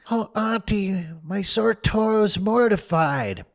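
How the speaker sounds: milk the pain, whining; moaning. Acted. Tom faking illness to Sid, moaning.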